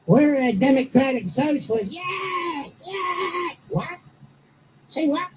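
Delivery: squeaky mocking caricature voice; shrill put-on voice mocking them; high mocking voice. Host mocking socialists in high voice.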